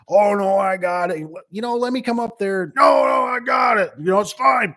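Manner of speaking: imitating a cocky driver waving off help; brushing off help. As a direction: shout it off breezily like a careless delivery driver.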